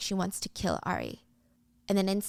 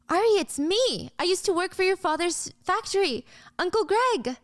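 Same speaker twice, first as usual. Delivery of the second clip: lightly voiced Uncle Greg; 11 semitones higher, 4 dB louder than usual. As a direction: call out glad to see her again. Old worker greets Ari happily.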